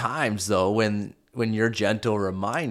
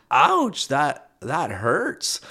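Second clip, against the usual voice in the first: mock ouch; 5 semitones higher, much slower than usual. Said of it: "I'm like".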